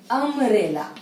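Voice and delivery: umbrella with a strong opening 'um' vowel; starts on vowel sound. Shown as a demonstration. Teacher shows learners the first sound of umbrella, clear vowel onset. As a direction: open Umbrella on a clear 'um', falling at the end. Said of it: "don't say starting with U".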